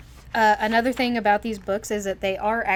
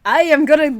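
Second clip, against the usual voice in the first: put-on Irish accent slipping into Scottish, loud and higher; botched Irish accent; 5 semitones higher, 11 dB louder than usual. Host voices the girl's dad, failed Irish accent. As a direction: badly attempt an Irish accent, pitched up. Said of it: "That's not even Irish. It's Scottish".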